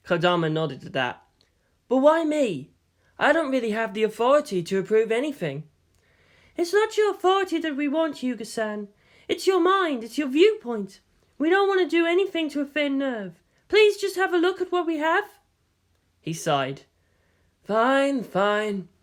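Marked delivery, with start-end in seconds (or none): 1.9-5.7 put-on higher, puzzled young man; ask, genuinely puzzled. Makoto protests. 6.6-15.4 put-on much higher, coaxing young woman; pleading young woman. Woman persuades Makoto. 17.6-18.9 higher, giving in reluctantly; reluctant young man. Acted. Makoto gives in.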